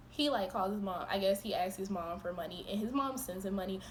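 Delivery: ordinary talk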